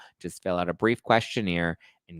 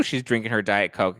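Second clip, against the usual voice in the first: normal talking voice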